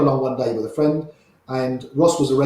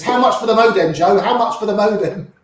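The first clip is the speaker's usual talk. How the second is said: higher, louder mock urgency; pitched-up, pressing imitation of a desperate buyer; urgent retold plea; 6 semitones higher, 4 dB louder than usual. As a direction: imitate a friend chasing you with an urgent offer. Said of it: "Ram comes running after me".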